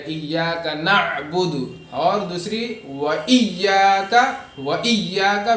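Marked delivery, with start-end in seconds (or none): 0.1-1.5 slow ایاک نعبد, doubled y; careful "iyyaaka na‘-budu" for ایاک نعبد; sukun on ain. Shown as a demonstration. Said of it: "زبر ہمیں نہیں پڑھنا ہے". 3.0-5.5 و ایاک with a pressed double y; emphatic doubled y in و ایاک; firm tashdeed. Shown as a demonstration. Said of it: "یہاں پہ یا پہ تشدید ہے".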